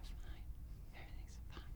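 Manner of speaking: barely voiced, very soft whisper; complete whisper. Shy flower girl whispering back to the bride. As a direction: breathe it out shyly. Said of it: "Like a complete whisper".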